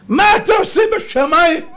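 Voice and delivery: high, loud, appalled shout; Persian father's alarm. Acted. Father asks son what he does in the sky, appalled shout. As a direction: demand an answer at full voice. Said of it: "אבא שלו היה בא אליו".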